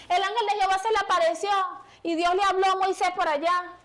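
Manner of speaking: fervent preacher building up; full-force preaching. Acted. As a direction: proclaim it to the congregation.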